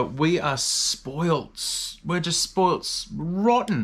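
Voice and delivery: very slow, stretched spoilt rotten; drawn-out emphasis. Real, not acted. Guest boasting about Australian meat to host, slow stretched emphasis. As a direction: lean hard on spoilt, dragging it.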